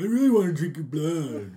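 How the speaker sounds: loud, laughing, badly faked vampire growl; Jesse Ventura impression. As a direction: ham up a tough-guy vampire. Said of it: "I can't do a good Jesse Ventura".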